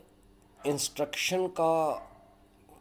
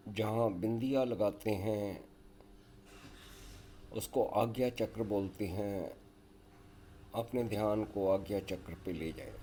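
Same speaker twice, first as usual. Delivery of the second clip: guided yoga nidra; 5 semitones lower, 8 dB quieter than usual. Acted. Leads a yoga nidra relaxation.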